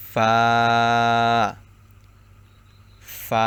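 careful demonstration of ফা, lip against teeth; lip-to-teeth letter. Shown as a demonstration. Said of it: "নিচের ঠোঁটের পেট".

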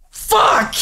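loud, high burst of swearing; shouted curse. Real, not acted. Player hit by a setback, shouted curse. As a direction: yell the curse out sharply.

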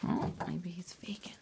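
breathy unvoiced whisper. Real, not acted. Whispering beside the napping child.

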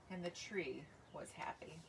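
whispered narration line; hushed. A narrator's reading. Author reading to kids whispers the tree's happiness. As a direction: breathe it out softly.

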